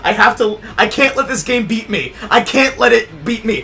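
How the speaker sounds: retold frantic insistence. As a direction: shout it like you refuse to quit. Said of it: "I'm like".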